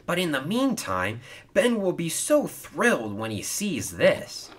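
high-pitched, whimsical musing; playful, lifted voice. Acted. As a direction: muse lightly with rising delight. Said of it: "he said whimsically".

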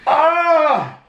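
sharp cry of burning pain; pained yell. Real, not acted. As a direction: yelp from a burning tongue. Tongue burning from hot chip.

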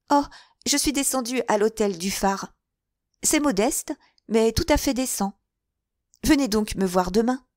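put-on a girl inviting him carelessly; airy young woman; young woman, breezy. Girl giving her address.

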